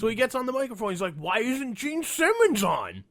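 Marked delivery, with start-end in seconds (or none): 1.2-3.0 slow, high, louder dim voice; mock dim fan asking, slow; slow dopey fan. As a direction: play the clueless fan. Dumb fan question.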